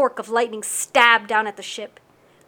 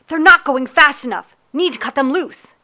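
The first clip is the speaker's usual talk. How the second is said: loud, high urgent yell; screamed; 4 semitones higher, 9 dB louder than usual. Acted. Captain ordering the sails cut loose, screaming. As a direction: scream the order over the storm. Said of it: "Captain Yuki screamed".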